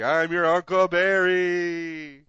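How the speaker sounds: spooky ghost voice. Pretend. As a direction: drag it out like a graveyard spirit. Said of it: "Be like, that's me".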